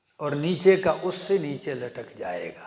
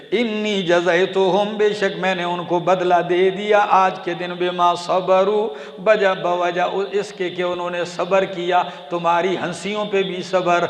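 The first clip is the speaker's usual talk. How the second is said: chanted Quran verse, then ardent translation; preaching recitation; 4 dB louder than usual. Acted. Preacher reciting to congregation, fervent chant.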